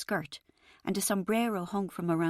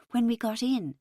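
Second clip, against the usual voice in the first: ordinary delivery, nothing marked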